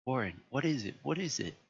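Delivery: pleading, pitch rising on the repeat; desperate questions into the receiver; scared repeated question; terrified. A narrator's reading. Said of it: "Terrified, I continued to repeat".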